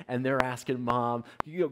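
normal talking voice

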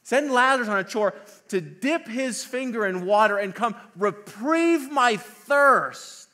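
high, loud, haughty mock demand; proud rich man. Rich man in hell wanting water, imperious mock demand. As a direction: demand it grandly, stretching the last words. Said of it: "That's the posture of the rich man".

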